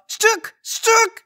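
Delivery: very high squeaky mock yell; yelling tiny aliens. Tiny police attacking with sticks, squeaky yells. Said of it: "they yell".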